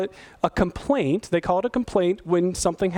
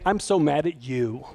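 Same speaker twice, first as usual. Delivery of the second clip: mock angry accusation; 6 semitones lower than usual. Shown as a demonstration. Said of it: "than saying".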